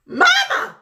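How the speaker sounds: very high, loud wail of mama; calling out. Retold or imitated. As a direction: call out to your mother for help. Mom calling grandma on pretend phone.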